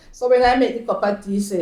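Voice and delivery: usual speaking voice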